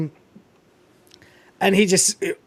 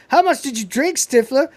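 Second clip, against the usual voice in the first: mock question; 8 semitones higher than usual. Mocking question to Stifler.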